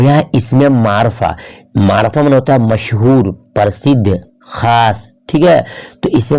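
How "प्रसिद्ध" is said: ordinary talk, nothing marked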